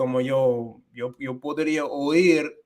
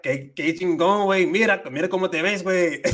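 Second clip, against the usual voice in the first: lively Mexican street Spanish, a bit higher; Mexican slang voice; 5 semitones higher than usual. Retold or imitated. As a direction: play it like chatting with Mexican buddies. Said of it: "I talk a little bit different too".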